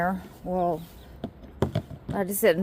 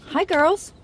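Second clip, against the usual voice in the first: high cooing greeting; sing-song hello to the hens; 10 semitones higher, 4 dB louder than usual. Real, not acted. Greeting chickens.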